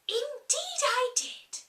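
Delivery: put-on high, delighted girl's voice; Alice, charmed. Alice thinking of the crab, high and charmed. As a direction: coo over the idea like a child.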